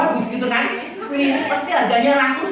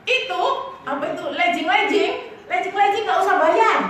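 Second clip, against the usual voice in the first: higher, brighter-pitched seller voice, offering it free; generous seller; 6 semitones higher than usual. Retold or imitated. Seller telling the girls the leggings are free, higher pitched. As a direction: toss out the freebie cheerfully. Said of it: "yang jual bilang".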